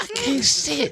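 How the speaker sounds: high squinting mock voice; straining to see. Comedian miming a look back at poverty, very high comic voice. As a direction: squeal it, amused.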